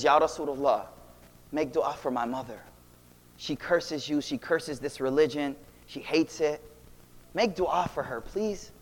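low, soft, subdued plea; understated, hushed begging; retold tearful plea. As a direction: quietly imitate a son pleading through sorrow. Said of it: "he comes, he's crying".

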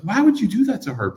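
high pleading reproach; incredulous reproach. Retold or imitated. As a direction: scold a friend in disbelief. Retold reproach to friend.

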